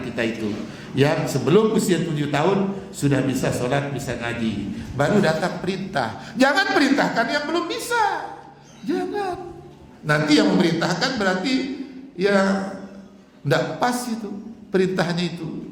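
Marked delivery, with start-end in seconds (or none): 6.3-9.5 sharp high-pitched warning; emphatic high warning. Real, not acted. To the congregation, a high emphatic prohibition. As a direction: lift the voice high to forbid. Preacher stressing a rule.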